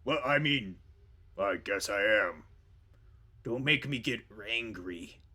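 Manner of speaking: loud, slow growly bear; big bear boss, threatening; bear boss Borak. Acted. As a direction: rumble a slow warning at underlings. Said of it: "And Borak goes".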